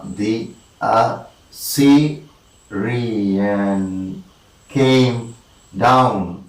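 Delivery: "the A-ssy-ri-an came down" in separate slow beats; halting, drawn-out metrical count; syllable-by-syllable scansion. Shown as a demonstration. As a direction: isolate each syllable, with long pauses. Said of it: "two unstressed followed by one stressed".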